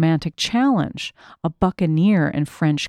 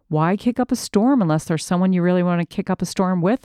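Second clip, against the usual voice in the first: usual speaking voice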